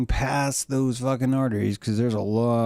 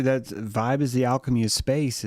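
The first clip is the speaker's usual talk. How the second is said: ordinary talk, nothing marked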